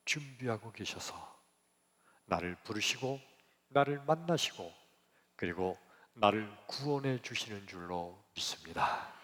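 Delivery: ordinary talk, nothing marked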